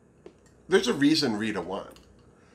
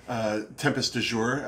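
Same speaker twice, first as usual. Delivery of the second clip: ordinary delivery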